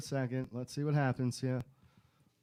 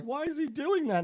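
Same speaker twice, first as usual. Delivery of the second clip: put-on high, slow child voices; puzzled kids; 12 semitones higher, 4 dB louder than usual. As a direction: ask it like a confused little kid.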